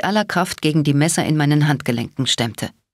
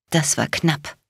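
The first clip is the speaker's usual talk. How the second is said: shaky smile; slower than usual. A narrator's reading. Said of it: "mit zittrigem Lächeln".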